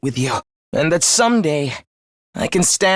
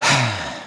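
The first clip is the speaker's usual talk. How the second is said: low, quiet exhale; weak sigh; 10 semitones lower, 4 dB quieter than usual. Acted. Before last words to comrades, weak low sigh. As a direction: let out a dying breath. Dying speech begins.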